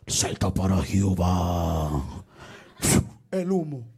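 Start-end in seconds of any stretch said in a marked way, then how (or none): 0.1-2.2 deep, slow mock club DJ; DJ hype voice. Church music leader as a DJ, mock deep hype call. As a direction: boom it out like a party DJ. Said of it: "como que es D J".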